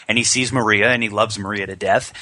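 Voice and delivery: normal talking voice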